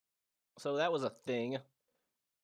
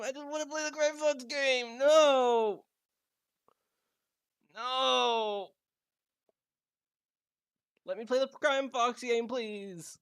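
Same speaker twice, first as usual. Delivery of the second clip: pleading with a machine; 7 semitones higher, 3 dB louder, slower than usual. Real, not acted. Begging the game.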